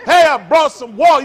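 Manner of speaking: booming, high-pitched comic shout; loud instead of hushed. Shown as a demonstration. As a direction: holler it across the room. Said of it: "I don't know why you talk quiet".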